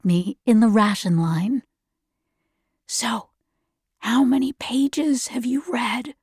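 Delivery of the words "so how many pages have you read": hushed whisper behind her back. Acted. Andrews asks Lina in secret, whispered. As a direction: breathe it so no one else hears. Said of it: "his voice whispered behind me".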